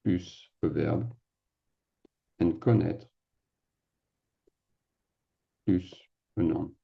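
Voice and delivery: "plus a verb" and "plus a noun" slowed with long pauses; slow emphatic rule. Shown as a demonstration. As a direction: lay out the rule word by word. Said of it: "what I like to say".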